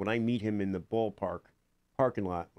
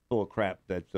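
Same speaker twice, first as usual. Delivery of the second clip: normal talking voice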